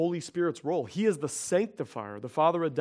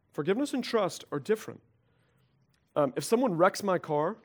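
usual speaking voice

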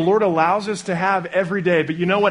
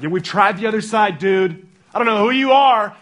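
higher and louder, fed-up pushback; exasperated fishermen; 6 semitones higher, 7 dB louder than usual. Retold or imitated. Tired fishermen snapping back at Jesus, mock exasperated. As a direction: brush off a stranger's advice.

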